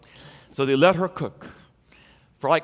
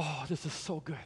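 savoring murmur; 13 dB quieter than usual. Retold or imitated. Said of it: "But he keeps talking".

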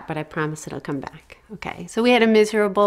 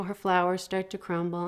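ordinary delivery

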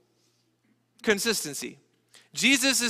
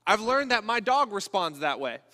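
ordinary talk, nothing marked

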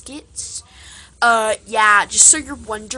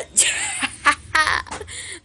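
breathless airy giggle, higher; real laugh; 6 semitones higher than usual. Real, not acted. Laughing at a silly moment with friends, a breathy giggle. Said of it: "that was so funny".

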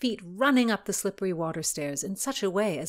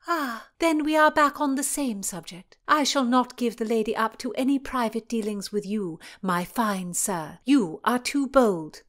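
scolding princess; 5 semitones higher, 5 dB louder than usual. Acted. Cecilia scolds.

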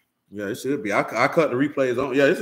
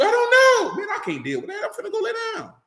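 mock sobbing man's voice, much higher; whiny crying man. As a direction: ham up a helpless weepy guy.

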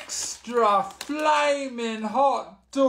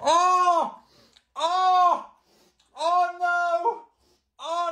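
mouth burning from spicy food; 3 semitones higher, slower than usual. Real, not acted. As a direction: cry out, mouth on fire. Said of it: "I'm in pain".

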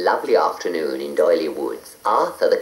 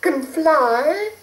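high hurried fairy; a sweet high fairy in a rush. A narrator's reading. Fairy dismayed.

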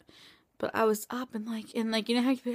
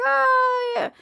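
a sudden high, loud "Oh, yeah"; mock doctor exclaiming; doctor's exclamation; 5 dB louder than usual. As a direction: burst out brightly as the doctor. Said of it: "she's like".

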